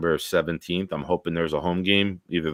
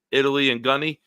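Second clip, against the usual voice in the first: ordinary talk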